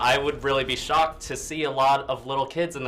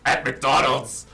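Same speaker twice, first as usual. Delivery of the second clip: Batman voice; 2 semitones higher, 6 dB louder than usual. Shown as a demonstration. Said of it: "It sounds a little bit like this".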